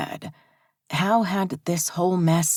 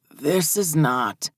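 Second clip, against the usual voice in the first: halting, stuck on the words; stuttering; slower than usual. Acted. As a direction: stumble over the words slowly. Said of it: "he stuttered".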